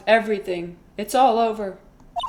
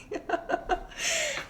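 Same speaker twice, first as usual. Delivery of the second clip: breakdown laugh; 9 dB quieter than usual. Real, not acted. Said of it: "mental breakdown".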